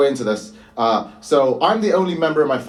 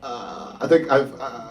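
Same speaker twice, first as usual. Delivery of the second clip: ordinary talk, nothing marked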